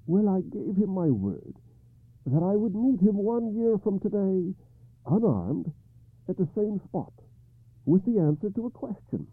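high, troubled king; King Arthur voice. Acted.

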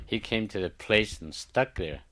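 plain reading voice

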